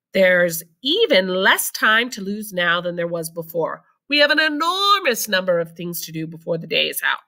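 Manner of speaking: ordinary delivery, nothing marked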